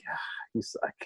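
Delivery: soft breathy little taunt from the son; son's taunt. Retold or imitated. Son teasing dad about the score, soft whispered taunt. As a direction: toss it off under the breath like a kid. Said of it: "He's like".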